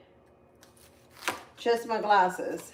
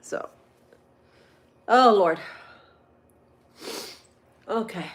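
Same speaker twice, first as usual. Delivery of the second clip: usual speaking voice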